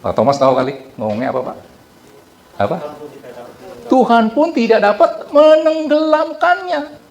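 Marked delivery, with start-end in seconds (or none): 3.9-7.0 much higher, louder, slow arrogant boast; boastful builder. Retold or imitated. The Titanic's maker boasts God can't sink it, slow proud boast. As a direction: proclaim it like a proud braggart. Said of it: "Terus dia ngomong apa?".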